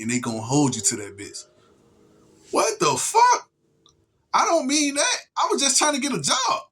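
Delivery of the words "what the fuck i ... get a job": much higher, panicked mock voice of someone tricked; shocked job seeker. Acting out a felon learning what he signed, high and panicked. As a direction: squeal it like a man who just got duped.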